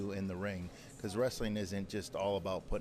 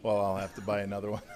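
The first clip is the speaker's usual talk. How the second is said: quick line that breaks into a laugh; laughing joke; 6 dB louder, faster than usual. Real, not acted. Wrestler joking to the host about losing his belt, laughing. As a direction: toss off the joke, then laugh.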